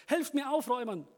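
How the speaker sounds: high, loud plea for help; urgent call. Acted. Man urges friends to hurry and help, loudly. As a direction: hurry them, calling loud. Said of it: "rief er".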